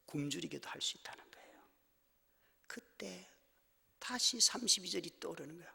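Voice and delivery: whispered, fast, soft; sudden whisper. Real, not acted. Preacher drops to a whisper for effect. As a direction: drop to a hush. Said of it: "sudden whisper".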